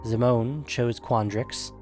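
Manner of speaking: usual speaking voice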